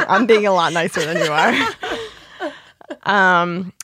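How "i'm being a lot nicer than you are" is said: laughing through the words. Real, not acted. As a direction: giggle while defending yourself. Laughing retort.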